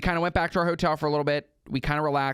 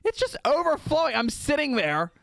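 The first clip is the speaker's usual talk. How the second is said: high-pitched, incredulous storytelling; exasperated alarm; 11 semitones higher than usual. Retold or imitated. Diner re-enacting watching his beer overflow, exasperated alarm. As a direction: voice leaps up in comic dismay.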